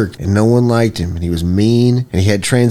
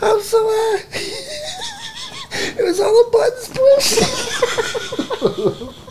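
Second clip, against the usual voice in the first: very high laughing wail; laughing cry; 3 dB quieter than usual. Pretend. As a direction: squeal it through laughter.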